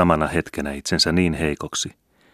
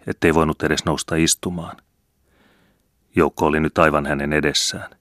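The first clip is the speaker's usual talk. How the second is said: usual speaking voice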